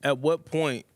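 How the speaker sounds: ordinary delivery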